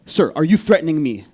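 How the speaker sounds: loud, indignant official; stern agent's challenge. Retold or imitated. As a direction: challenge him loudly, like a border agent. Agent challenges a screaming man.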